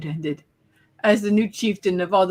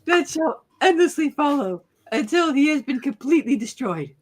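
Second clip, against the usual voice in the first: laughing; 6 semitones higher than usual. Real, not acted. Laughing through the line.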